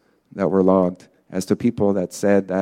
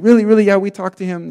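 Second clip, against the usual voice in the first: much higher, thrilled astonishment; excited crowd reaction; 12 semitones higher, 5 dB louder, faster than usual. Retold or imitated. Team learns the man said yes, excited. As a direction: imitate everyone exclaiming at once.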